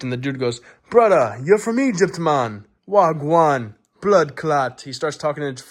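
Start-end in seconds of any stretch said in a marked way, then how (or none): 0.9-4.8 higher, louder put-on Jamaican accent; mock Jamaican accent. New acquaintance greeting an Egyptian stranger, in imitated Jamaican accent. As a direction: greet him in an exuberant bad Jamaican accent. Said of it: "in a fucking Jamaican accent".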